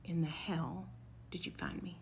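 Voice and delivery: hushed, breathless whisper of disbelief; retold stunned whisper. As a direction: whisper it as if you can't believe you were found. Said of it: "and I quote".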